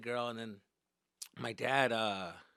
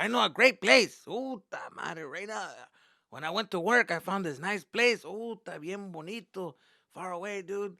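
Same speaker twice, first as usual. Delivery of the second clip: lightly voiced eager father, pitched up; imitated dad; 6 semitones higher, 5 dB louder than usual. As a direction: pitch the place as an enthusiastic dad. Dad voiced describing the place.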